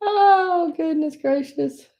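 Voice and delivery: laughing exclamation. Real, not acted. Reacting to her typo.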